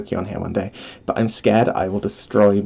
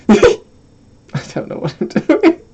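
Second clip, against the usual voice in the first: laughing; slower than usual. Real, not acted. Laughing at own noises.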